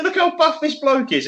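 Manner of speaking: high-pitched retold gushing; amazed admiration. Man showing the bodybuilder off, mock amazement. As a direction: imitate him marvelling, voice pitched right up.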